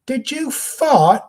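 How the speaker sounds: high, slow mock British lady asking did you fart; hammed-up posh English woman, way up high; rude British woman. Impersonating a rude woman on the show, a high British accent. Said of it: "a woman in the show very rudely said to him".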